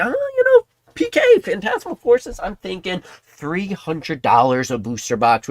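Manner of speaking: a squeaky, self-assured mock pundit; mocking Kimmy. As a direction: lampoon a know-it-all price guesser. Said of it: "um, Kimmy?".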